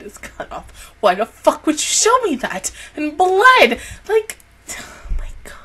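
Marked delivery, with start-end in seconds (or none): 4.8-5.5 horrified whisper; can hardly speak from shock. Real, not acted. Reacting to a severed hand, in a whisper.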